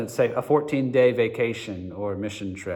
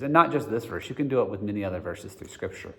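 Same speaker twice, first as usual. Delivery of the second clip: ordinary talk, nothing marked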